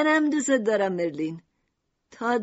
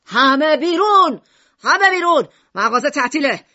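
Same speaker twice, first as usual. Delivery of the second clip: furious louder shout ordering everyone out; 3 semitones higher, 8 dB louder than usual. Acted. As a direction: bellow to clear the shop. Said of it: "میشیما فریاد کشید".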